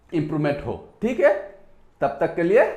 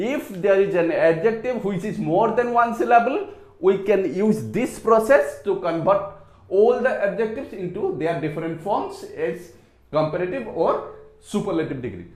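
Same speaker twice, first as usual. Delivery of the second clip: slow explanation; much slower than usual. Shown as a demonstration. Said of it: "teacher explains rule slowly".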